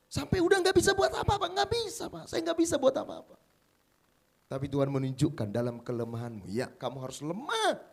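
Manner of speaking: high, loud impassioned preaching. Acted. Pastor urging the congregation, impassioned high preaching. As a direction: pour it out as the sermon's climax.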